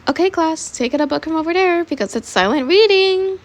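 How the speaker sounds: put-on teacher voice; teacher to class.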